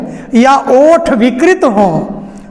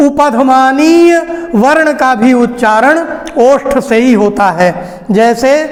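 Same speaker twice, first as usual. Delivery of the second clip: usual speaking voice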